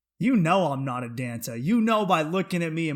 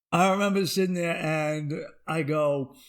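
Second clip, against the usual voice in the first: ordinary talk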